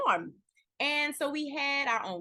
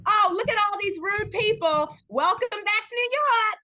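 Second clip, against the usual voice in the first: mock disgust, raised; 7 semitones higher, 6 dB louder than usual. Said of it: "Oh, that person".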